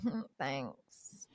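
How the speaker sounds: soft, low brush-off; mild polite shrug of a thanks; dismissive thanks. Retold or imitated. Woman brushing off a street compliment, quiet low brush-off. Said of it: "I'll be like".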